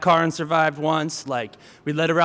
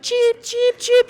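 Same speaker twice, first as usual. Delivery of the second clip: very high bird cheeps; chirping parakeets; much slower than usual. Retold or imitated. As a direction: chirp like little birds. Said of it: "all the other parakeets".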